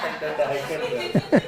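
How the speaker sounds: giggling through the words, slowed down; laughing. Real, not acted. Student laughing at his own joke to the teacher. Joking about inverse sati.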